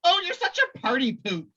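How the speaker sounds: sing-song teasing jab; high teasing Bobby voice. Retold or imitated. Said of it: "Bobby's like".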